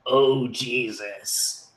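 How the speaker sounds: low, soft, very slow mimicry; mocking Predator playback. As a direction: drag it out as a taunting creature echo. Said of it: "this mocking asshole".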